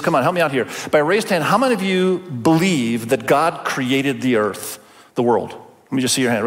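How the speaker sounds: usual speaking voice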